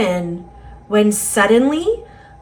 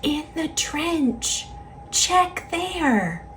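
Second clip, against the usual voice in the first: hushed and higher, a gentle hint; soft whisper; 5 semitones higher, 8 dB quieter than usual. A narrator's reading. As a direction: whisper the clue softly. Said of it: "that soft voice whispered".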